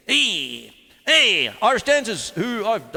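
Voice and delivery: ordinary talk, nothing marked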